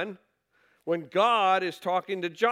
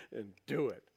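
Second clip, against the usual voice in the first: soft, lower repeat of do it; quiet echo; 8 semitones lower, 10 dB quieter than usual. Shown as a demonstration. Preacher echoing scripture softly to the congregation, quiet. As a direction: let the words do it drop to a murmur.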